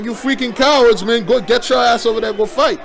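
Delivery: hammed-up taunt of cowards; mock angry yell. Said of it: "Those guys are like".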